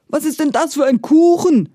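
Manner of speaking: high, loud, wailing giant's complaint. Acted. Giant wails at Maria over the stone-hard cake. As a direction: wail it out after biting on stone. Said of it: "jammerte er".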